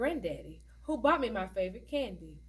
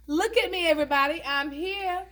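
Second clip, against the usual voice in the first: much higher and louder, a loud mock cry for attention; playful mocking proclamation, much higher and louder; showy hat calling out; 8 semitones higher, 7 dB louder than usual. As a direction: shout it out like a flashy hat begging to be seen. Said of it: "You always seem to get one that says".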